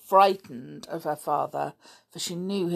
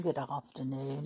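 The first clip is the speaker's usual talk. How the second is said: quiet, lower repeat of the greeting; soft greeting; 3 semitones lower, 9 dB quieter than usual. Shown as a demonstration. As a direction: say it softly, almost to yourself.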